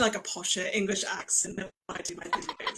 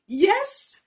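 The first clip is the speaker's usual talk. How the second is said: bemused reply; 13 semitones higher, 8 dB louder than usual. Retold or imitated. Bemused reply to doubters in retold story.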